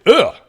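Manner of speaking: loud high disgusted ugh; disgusted grunt. Acted. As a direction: grunt in revulsion. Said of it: "you hear him audibly say".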